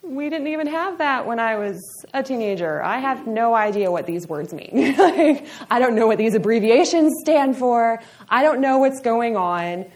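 hammed-up clueless grown-up, rising in volume; bewildered older generation. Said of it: "and you're like".

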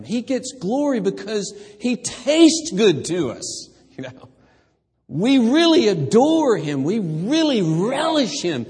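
high, loud, exultant sermon build; full-force preaching. Acted. Preacher urging the church to relish God, loud and high. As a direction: preach it out with rising joy.

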